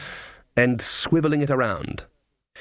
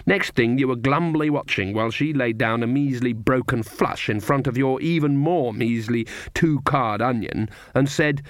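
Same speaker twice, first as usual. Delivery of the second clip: usual speaking voice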